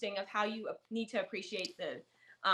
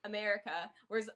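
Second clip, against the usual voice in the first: ordinary talk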